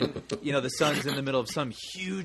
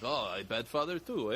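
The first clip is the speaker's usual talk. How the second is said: mock Russian accent; 5 dB quieter than usual. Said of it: "the Russian guy just like".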